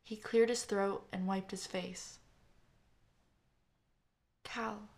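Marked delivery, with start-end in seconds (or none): 4.4-4.9 much softer, just his name; gently, barely above a breath; saying his name softly as they meet after a funeral. Acted. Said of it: "I said softly".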